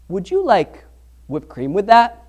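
lightly mimicked server, long pause before whipped cream; mild imitation of a hesitant cashier; server's slow drawn-out question. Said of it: "That's how he said it".

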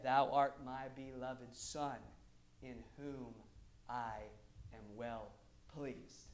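slow, hushed, low recital; low, reverent, unforced quote; undramatized voice of God. Acted. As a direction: say it softly and simply, with long pauses. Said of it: "I'm not even gonna try to dramatize it".

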